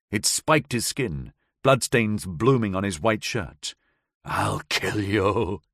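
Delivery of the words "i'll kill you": lightly voiced slow whimpered threat in pain; a mild, hurting whimper of a threat. A narrator's reading. Howard curled in agony on the glass, mild whimpered threat. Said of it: "he whimpered".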